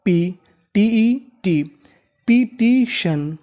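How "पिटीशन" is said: पिटीशन very slow and higher, in three pieces; syllable-by-syllable. Shown as a demonstration. Teacher joins the parts just spelt, syllable-by-syllable. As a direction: stretch and separate each syllable of पिटीशन. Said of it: "तो meaning क्या बनी".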